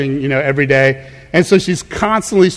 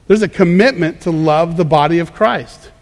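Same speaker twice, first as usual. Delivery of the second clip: normal talking voice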